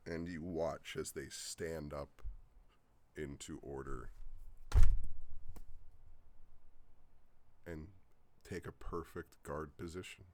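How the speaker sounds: slow, drawn-out scene narration; story narration. Acted. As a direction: set the scene slowly and grandly. GM scene setting.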